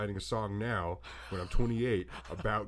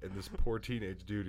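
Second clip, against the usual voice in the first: ordinary talk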